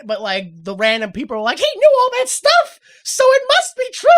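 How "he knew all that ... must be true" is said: very high, loud crowd cry; hammed-up panicked accusation; mock mob hysteria. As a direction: imitate a frenzied neighbour yelling. Acts out frenzied neighbours.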